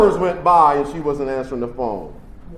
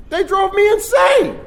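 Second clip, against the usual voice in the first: heated outburst; 3 dB louder than usual. Real, not acted. Angry outburst about family.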